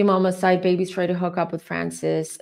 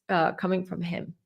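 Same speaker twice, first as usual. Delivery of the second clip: ordinary delivery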